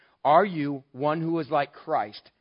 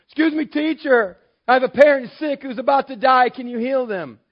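high, loud urgent plea for a dying parent; bold interrupter; 7 semitones higher, 10 dB louder than usual. Pretend. A man begs the teacher to heal his parent, high and loud. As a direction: call out, begging for healing. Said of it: "that took some boldness".

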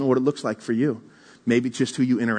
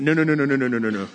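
rapid refusal; faster than usual. Retold or imitated. Refusing wife's urging to leave.

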